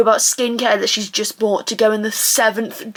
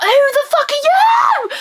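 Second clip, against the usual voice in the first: very high, drawn-out shout; indignant screech; 14 semitones higher, slower than usual. Acted. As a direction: screech it in outrage. Outraged at people gossiping.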